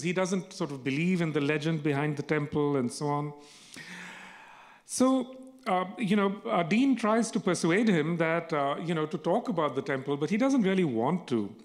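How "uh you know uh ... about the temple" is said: usual speaking voice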